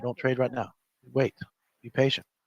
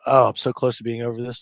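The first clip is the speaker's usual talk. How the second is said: normal talking voice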